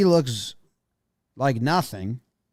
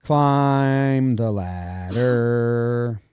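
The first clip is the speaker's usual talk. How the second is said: very slow, drawn-out character voice; hammy, long-held syllables; put-on silly voice; 4 dB louder, much slower than usual. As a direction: stretch every word way out. Performing a silly voice on request.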